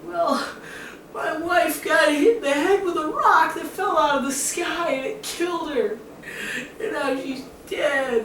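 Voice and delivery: hammed-up high-pitched tearful wail; grief-stricken whine, way up high; mock bawling. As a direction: blubber the words like a sobbing cartoon man. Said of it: "bawling, crying again".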